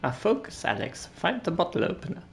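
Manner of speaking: inner voice urging focus; a stern little inner voice; voice of conscience. Acted. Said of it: "said the conscience speaking".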